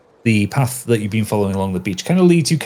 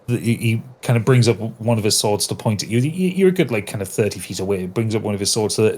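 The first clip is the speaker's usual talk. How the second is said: normal talking voice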